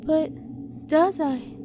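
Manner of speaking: put-on high hesitant child's protest; a small girl, hesitantly protesting; hesitant little girl. A narrator's reading. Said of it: "Elise protested hesitantly".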